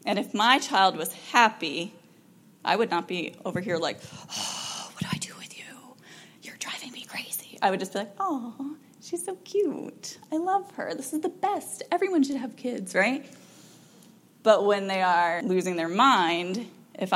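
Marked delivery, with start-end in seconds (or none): 4.3-7.6 mock exasperated parent, whispered. Parent at wits' end with the child, exasperated whisper. 8.2-12.9 put-on high, softened gushing over a cute kid; gushing parent. Parent adoring the happy child, high gushing coo.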